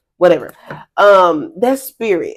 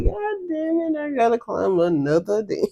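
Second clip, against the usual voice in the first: soft, defeated mutter; resigned grumble; 5 semitones higher, 6 dB quieter than usual. Retold or imitated. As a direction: sigh it out, worn down. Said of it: "and say".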